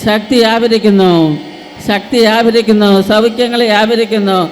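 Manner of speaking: full-force preaching. Acted. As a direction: stir the crowd to cry out.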